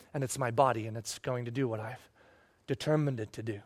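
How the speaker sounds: very low and hushed, with pauses; God's quiet, unshakeable resolve; solemn divine vow. Acted. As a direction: drop right down and promise it softly. Speaking as God, vowing protection.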